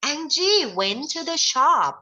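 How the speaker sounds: "Angie went to the shop" read slowly, high and loud; bright classroom model reading of "Angie went to the shop". Shown as a demonstration. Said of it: "Angie ไปที่ร้าน".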